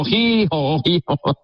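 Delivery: mock donkey bray. Donkey noise as siren.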